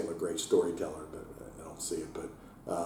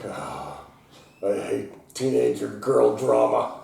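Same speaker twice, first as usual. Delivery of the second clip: exasperated groan; 4 semitones higher, 5 dB louder, much slower than usual. Retold or imitated. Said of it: "I'm like".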